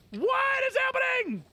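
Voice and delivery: high shouted disbelief; real shock; astonished shout. Real, not acted. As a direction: yell in amazement. Shiny find disbelief.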